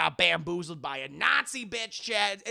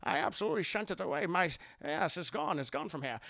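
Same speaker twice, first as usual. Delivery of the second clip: quiet, slushy-s older father impression; Sean Connery; 4 dB quieter than usual. Father assures the Nazis, slurred Scottish star impression. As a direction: lightly slur the s into sh. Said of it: "like, Doctor Jones is like".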